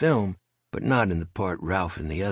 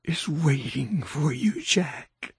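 slow, high, otherworldly hiss; eerie creature voice; 5 semitones higher, much slower than usual. Acted. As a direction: speak like a ghoul taunting its prey. Monster taunts Jack.

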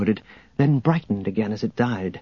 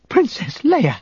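much higher, aghast cry; shocked exclamation; 12 semitones higher, slower than usual. A narrator's reading. Scandalised droid protesting the princess's deal, high cry. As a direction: exclaim in high-pitched dismay.

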